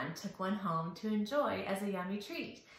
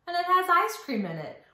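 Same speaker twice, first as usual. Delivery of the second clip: excited; 7 semitones higher, 6 dB louder, much faster than usual. Acted. Hyping the book for kids.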